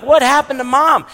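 put-on child's high bewildered question; puzzled kid. Kids at the game asking about mom, high and puzzled. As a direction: ask like a confused kid.

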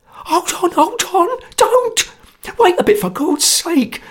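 very high, panicky squeal; terrified cry. A narrator's reading. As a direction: beg them to stop, in a sweat of terror. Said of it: "cried Sotcher in a sweat of terror".

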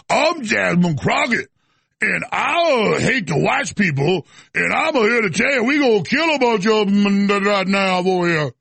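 slow, drawn-out mock accent, hammed up; Jasmine Crockett. Host mocking congresswoman's changed voice, slow put-on accent. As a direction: exaggerate her new accent, dragging every word. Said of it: "Now she's like".

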